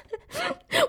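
a very high wailing laugh-cry; half laughing, half wailing. Real, not acted. Laughing-crying at the memory. (又哭又笑的尖声)

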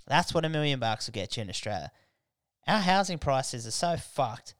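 usual speaking voice

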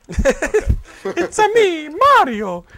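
very high, loud, slow cartoon Italian; Mario. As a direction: squeal it out like a video-game plumber. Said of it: "That's what he sounds like".

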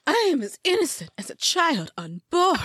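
high, hammed-up plea of innocence; dramatic accused woman. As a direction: overact it like a stage martyr. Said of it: "I'm gonna need better acting".

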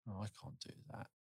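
whispered, fast and despairing; hushed hopeless murmur; defeated whisper. Retold or imitated. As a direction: breathe it out like giving up. Despairing painter quote.

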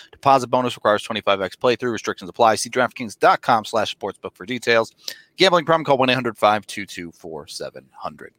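rapid-fire legal fine print; fast legal disclaimer. Acted. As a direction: rattle off the disclaimer quickly.

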